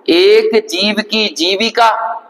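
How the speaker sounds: slow, deliberate couplet line; reciting a verse. Shown as a demonstration. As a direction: lay each word down slowly. (धीमे स्वर में दोहे की पंक्ति)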